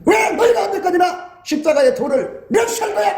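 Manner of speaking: a high, scolding rebuke as God; hammed-up divine anger; mock angry God. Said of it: "하나님이 화를 내버리면". (화난 하나님을 흉내 낸 높은 꾸짖음)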